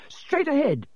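high-pitched urgent call; sudden high-pitched cry; shout. A narrator's reading. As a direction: call it out high, with contained volume. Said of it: "Harry shouted".

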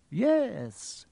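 high, drawn-out dreamy yes. A narrator's reading. Old headmaster recalling the past to Harry, dreamy. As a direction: let the word drift out dreamily. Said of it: "said Dumbledore dreamily".

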